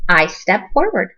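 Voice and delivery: usual speaking voice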